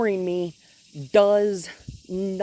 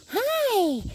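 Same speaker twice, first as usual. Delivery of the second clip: much higher sing-song cooing. Real, not acted. Trainer greets the dog Oliver in high sing-song baby talk.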